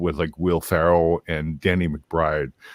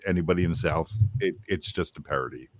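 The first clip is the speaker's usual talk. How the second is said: ordinary delivery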